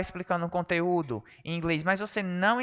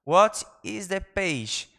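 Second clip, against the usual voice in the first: very slow, careful clean take; slow clean retake; 2 semitones higher, much slower than usual. Shown as a demonstration. Teacher redoing the phrase for students, slowly. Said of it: "vamos lá de novo".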